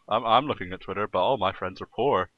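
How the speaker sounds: usual speaking voice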